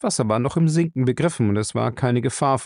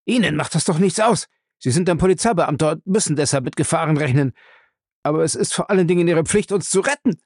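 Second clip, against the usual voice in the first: panicky whining; 6 semitones higher than usual. Acted. Panicked coward pleads.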